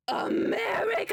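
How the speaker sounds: high mock-patriotic shout; patriotic cry. Streamer cheering America ahead of the England match, shouting. Joking about the US-England game.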